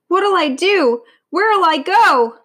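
high, loud, drawn-out wail; panicked wail. A narrator's reading. Lion hunted by spearmen, panicked wail. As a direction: wail helplessly, stretching the last words. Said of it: "wailed Eli, sitting up with a shiver of fright".

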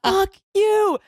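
high-pitched mock-mad retort; indignant comeback, retold for laughs; retold outrage. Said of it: "I was so mad".

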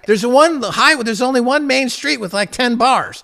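loud, high-pitched enthusiasm; excited rant. Real, not acted. Host pitching Iceland to lonely men, excited rant. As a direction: sell it hard, worked up.

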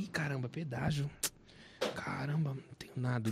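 low, muttered worry under the breath; quiet retold grumble; muttered dismay. Retelling friend's dismay, muttered.

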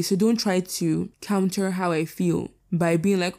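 usual speaking voice

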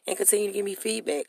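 usual speaking voice

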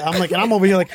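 ordinary talk

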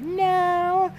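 very high, squealed imitation; mock scandalized no. Girlfriend asked if she told her friends, mock scandalized squeal.

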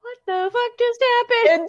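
high, stunned confusion. Retold or imitated. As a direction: sound completely baffled. Mock confusion.

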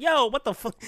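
indignant mock yell; outraged callout. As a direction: confront him as the offended crowd did. Retold outraged confrontation with coworker.

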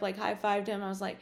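ordinary talk, nothing marked

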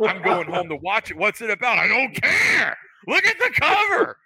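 giddy, rising to a high shout; a ten-year-old gushing over a cover; excited kid. Pretend. Grown man acting like a giddy ten-year-old. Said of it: "I'm just a big ten-year-old".

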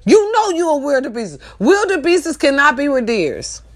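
ordinary delivery

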